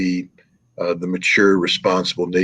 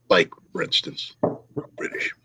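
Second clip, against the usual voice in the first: whispered jab, trailing away softer; mock whisper; 6 dB quieter than usual. Real, not acted. Interviewee names the pathetic nation he means, mock whisper. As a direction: drop into a hushed, voiceless whisper.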